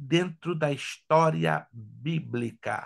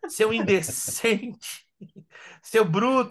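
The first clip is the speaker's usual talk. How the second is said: offended wife; 5 semitones higher, slower than usual. Pretend. Teacher voices offended wife.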